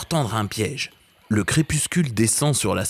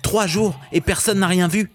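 s'écrie, indigné; 8 semitones higher than usual. Acted. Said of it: "s'écrie Okazu".

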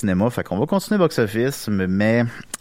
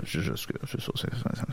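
whispering; 13 dB quieter than usual. Real, not acted. Whispered aside.

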